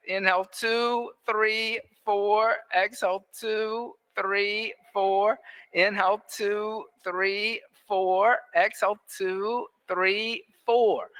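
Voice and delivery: evenly spaced, measured counting; metronome breath count. Real, not acted. Yoga teacher pacing the class's breathing, metronome count. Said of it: "that metronome breath count".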